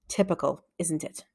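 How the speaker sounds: quiet sulky mutter. A narrator's reading. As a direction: grumble it low to yourself. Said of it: "muttered Jeff".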